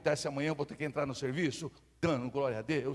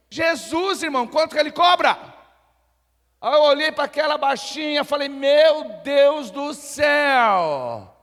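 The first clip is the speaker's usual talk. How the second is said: high, loud and fast cry; exultant shout; 11 semitones higher, 14 dB louder, slower than usual. Acted. Preacher shouting Jesus is the lawyer. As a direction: shout it out with joy.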